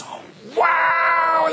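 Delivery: high, thrilled cry of wow; excited shout. Retold or imitated. Family seeing their rebuilt house, high excited shout. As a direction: gasp out a delighted wow up high. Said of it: "everybody goes".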